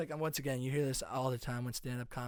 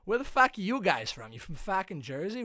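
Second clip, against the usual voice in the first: high, loud Boston masshole; Massachusetts accent; 6 semitones higher, 5 dB louder than usual. Retold or imitated. Masshole questioning Jersey visitors, brash Massachusetts accent. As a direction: quiz them in brash, high-pitched Boston. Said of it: "I just mastered the guy's accent".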